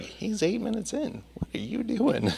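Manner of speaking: high mock puzzled crowd; high, soft mock puzzlement; mock congregation. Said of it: "they're like".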